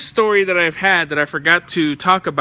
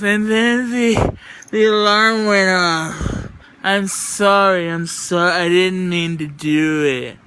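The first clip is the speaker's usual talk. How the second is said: imitating a dazed woman; 2 semitones higher, slower than usual. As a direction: mimic the spaced-out stranger saying sorry over and over. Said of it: "and then she goes".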